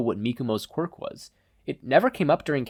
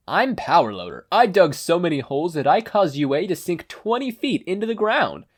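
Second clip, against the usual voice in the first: higher, boastful digger voice; Power Loader impression; 6 semitones higher, 4 dB louder than usual. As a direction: brag about the holes. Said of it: "voice of Higari Majima".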